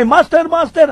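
high, loud echo call; echo effect. Shown as a demonstration. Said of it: "yun ang echo".

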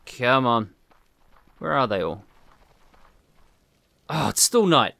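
usual speaking voice

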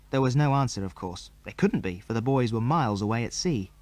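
usual speaking voice

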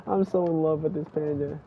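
normal talking voice

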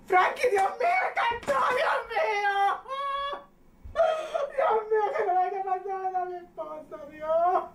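sobbing cries to God, rising and falling; wailing, crying prayer. Acted. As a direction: cry out to God for her son. Madre llora y grita por su hijo. (llanto desesperado rezando por su hijo)

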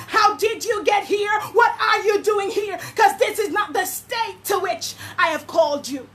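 preacher shouts God's question; God asking. Acted. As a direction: demand it as the Spirit. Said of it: "God is asking".

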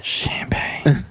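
reverent whispered name. Pretend. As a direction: breathe the name like a secret.

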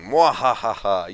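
slow booming villain cackle; evil laugh. Acted. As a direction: throw back your head and cackle. Reading villain dialogue in game.

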